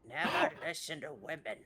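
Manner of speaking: with a lisp, like a lost tooth; a light lisp on every word. Pretend. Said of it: "he said that with, like, a, a little lisp".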